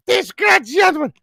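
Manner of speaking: high slow screech; mock Italian scream. Imitating mother yelling before the phone rings, high screech. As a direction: screech it like a furious mom. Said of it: "they're like".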